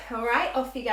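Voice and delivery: usual speaking voice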